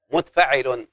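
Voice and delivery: ordinary talk, nothing marked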